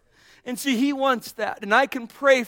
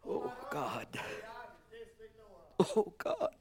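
much softer, breathed prayer with a soft laugh; hushed prayer; 17 dB quieter than usual. Real, not acted. Preacher quietly praying to God at the close, hushed. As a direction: murmur it as a private prayer.

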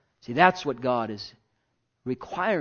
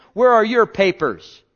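mocking accusers; 7 semitones higher, 9 dB louder than usual. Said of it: "what they were saying to him".

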